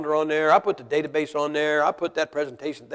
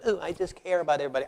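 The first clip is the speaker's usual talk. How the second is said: mock soft man; 5 dB quieter, faster than usual. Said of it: "men walking around like women".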